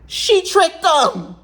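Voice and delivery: high, slow, sneering imitation of a gossiper; mocking gossip voice. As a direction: drag it out like a snide onlooker. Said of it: "somebody would say".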